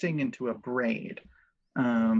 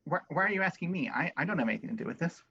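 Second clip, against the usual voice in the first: defensive protest; 2 semitones higher, much faster than usual. Acted. Character protests, fast.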